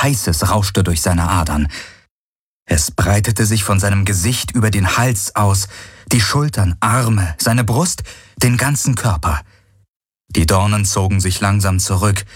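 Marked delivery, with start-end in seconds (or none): none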